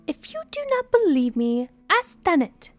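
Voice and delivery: high, loud indignant challenge; loud complaint. Acted. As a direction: insist loudly and call on a witness. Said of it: "Sunny popped up and complained".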